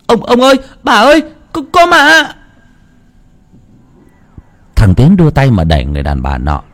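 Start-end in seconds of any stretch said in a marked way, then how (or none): 0.1-2.3 put-on very high, panicked child crying for help; terrified scream. Tiến screams for his grandparents, very high and panicked. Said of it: "nó hét lên".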